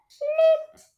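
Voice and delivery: very high, loud pop of a word; playful sound effect; flip. A narrator's reading. Sound word in read story.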